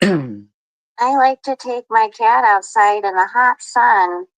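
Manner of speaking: slow, high mocking impression. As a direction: drag it out like her. Mocking Nut Nut's posts.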